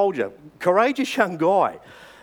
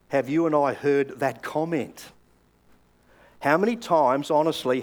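usual speaking voice

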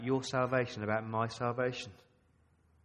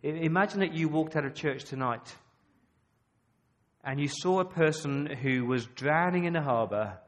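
usual speaking voice

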